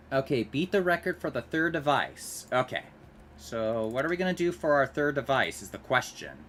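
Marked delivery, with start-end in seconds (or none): none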